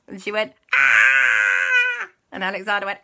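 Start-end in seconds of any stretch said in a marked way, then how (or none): 0.7-2.1 long, loud wail; hammed-up roller coaster scream; acted ride scream. Aunt screams on the drop, long loud cry.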